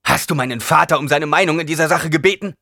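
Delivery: loud, high accusation; bitter, shouted question; angry demand. A narrator's reading. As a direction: confront her at full voice.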